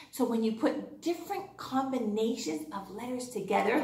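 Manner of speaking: usual speaking voice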